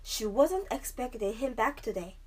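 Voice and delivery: plain reading voice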